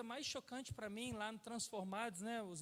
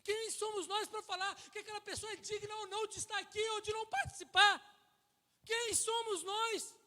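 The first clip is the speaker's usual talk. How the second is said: fiery preaching; 9 semitones higher, 7 dB louder than usual. Real, not acted. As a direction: hammer the question at the congregation. Preaching at full force.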